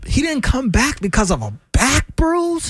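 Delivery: high, disbelieving question; incredulous, pitch climbing on the question. Real, not acted. Mocks critics over a minor injury.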